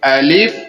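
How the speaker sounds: الف said as a clear open alif, drawn out; الف slow and clear as in Qur'an recitation; correct alif. Shown as a demonstration. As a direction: model the letter الف cleanly for students. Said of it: "اس کو درست الگ پڑھیں گے".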